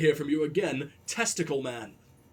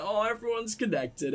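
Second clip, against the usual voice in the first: ordinary talk, nothing marked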